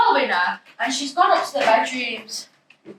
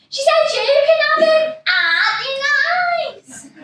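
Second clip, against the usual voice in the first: manic sing-song; 13 semitones higher, 4 dB louder, slower than usual. Acted. Hyper mother gushing.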